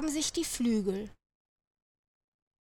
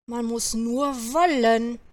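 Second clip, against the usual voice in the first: usual speaking voice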